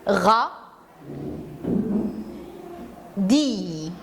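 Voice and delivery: Ra and Dio split apart with a long pause between; teacher spelling out syllables for young pupils. Shown as a demonstration. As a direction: tap out Ra, Dio as two separate beats. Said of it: "Regardez avec moi".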